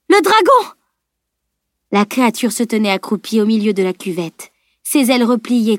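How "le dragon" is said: very high alarmed shout; yelling. A narrator's reading. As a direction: yell out at the creature's arrival. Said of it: "hurla Camille". (cri aigu et hurlé)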